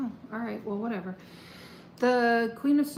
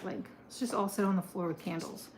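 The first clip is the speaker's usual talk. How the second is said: normal talking voice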